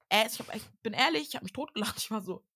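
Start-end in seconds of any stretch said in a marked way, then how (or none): none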